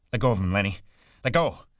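loud command climbing high; alarmed, forceful order; urgent shout. Acted. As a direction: shout to call him off. George calling off Lenny in fight.

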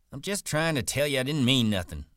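high-pitched, defensive explaining; worked-up backpedalling; defensive protest. Acted. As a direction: insist you meant no harm, pitched well up. Curly backing down before Slim.

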